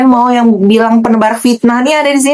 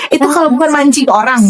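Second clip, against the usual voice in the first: usual speaking voice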